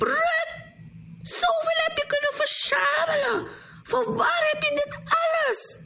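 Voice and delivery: very high-pitched exclamation of amazement; astonished cry. A narrator's reading. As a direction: call out in high, squealing disbelief. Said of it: "En ze riep".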